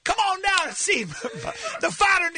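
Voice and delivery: high, hammed-up Cajun pitch, bad New Orleans accent; terrible New Orleans accent. Inviting listeners down to the bayou, bad New Orleans accent. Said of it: "I love that that's a terrible accent".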